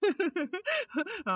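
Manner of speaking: high, bubbling laughter; amused laugh. Acted. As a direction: crack up at the squabble. Man amused by the seat squabble. (வேடிக்கையான உரத்த சிரிப்பு)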